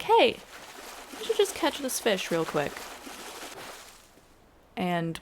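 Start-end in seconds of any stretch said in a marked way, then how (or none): 0.0-2.7 sudden high Hey, then a lightly acted aside; self-quoted eager thought. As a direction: act out a quick impulsive idea to yourself. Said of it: "I'm like".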